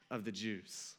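ordinary delivery

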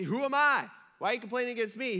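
high, loud protest, then quickening; mock incredulous. Moses questioning the grumblers, mock incredulous. Acting Moses answering the complainers.

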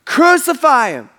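high, loud demand; retold mob shout; crowd shouting. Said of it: "they kept shouting all the more".

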